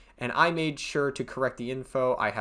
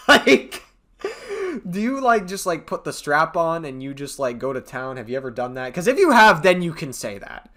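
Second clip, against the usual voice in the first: mocking rant; 6 semitones higher, 8 dB louder than usual. Ranting mock at women.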